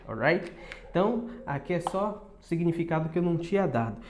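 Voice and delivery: usual speaking voice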